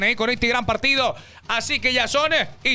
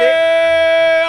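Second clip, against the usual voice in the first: excited cheer; 7 semitones higher, 9 dB louder than usual. Real, not acted. Cheering the result.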